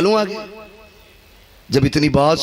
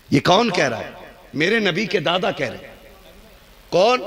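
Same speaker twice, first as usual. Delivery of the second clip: ordinary talk, nothing marked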